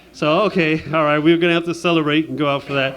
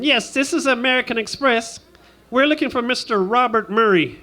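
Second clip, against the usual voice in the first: higher-pitched formal caller voice; company caller; 6 semitones higher than usual. Acted. American Express calling for Robert Murray, company caller. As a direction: put on a company phone-agent voice. Acts a credit card caller.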